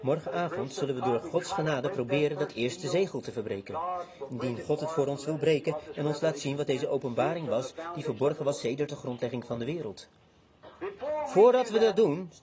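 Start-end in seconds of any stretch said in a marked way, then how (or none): 0.0-10.1 low, soft closing prayer voice; prayerful hush. Real, not acted. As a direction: bring them gently into prayer. Calls for bowed heads, closing prayer tone.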